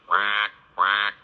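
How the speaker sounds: duck quacks, loud and bright; playful duck quack. Pretend. Imitating duck sound.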